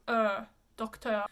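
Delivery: Docteur with lips pushed out; French eu vowel. Shown as a demonstration. Teacher demonstrates the lip position to students, French pronunciation. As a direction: pout for the "uh" in Docteur. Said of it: "just stick your lips out".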